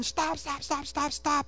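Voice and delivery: high, urgent mock yell of stop; retold yelling. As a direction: pitch shot way up, repeating stop in alarm. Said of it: "he starts yelling".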